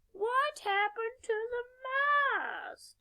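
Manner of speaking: high, slow stretched shout; shocked shout. Acted. Stranded crew shouts about the missing mast, high and slow. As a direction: cry it out in disbelief. Ship fails without a mast.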